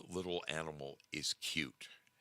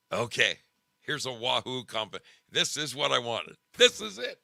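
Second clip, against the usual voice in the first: rising thrilled outburst, louder and higher; excited discovery; 4 semitones higher, 7 dB louder than usual. Real, not acted. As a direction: burst out as you finally find it. Finally finds the clip she wanted.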